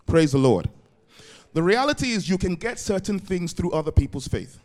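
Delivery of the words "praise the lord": ordinary delivery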